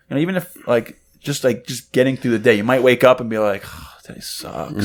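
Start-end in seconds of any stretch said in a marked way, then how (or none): 3.7-4.9 low, soft, defeated mutter; gloomy mumble. Retold or imitated. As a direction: sigh it out, unmotivated. Retold gloomy morning thought.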